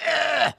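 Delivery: strangled high squawk, mock rooster with snipped vocal cords; hammed-up strangled rooster cry; choked rooster squawk. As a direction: squeeze out a choked crow. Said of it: "And they go".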